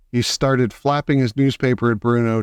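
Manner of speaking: ordinary delivery, nothing marked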